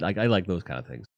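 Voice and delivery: ordinary talk, nothing marked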